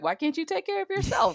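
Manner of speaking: high scolding jab, lightly voiced; mock scolding. Mocking older generation scolding millennials, high scolding jab. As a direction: toss it off like an impatient elder.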